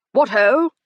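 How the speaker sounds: a loud, breezy greeting. A narrator's reading. Captain greeting everyone on arrival, loud and breezy. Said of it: "he said breezily".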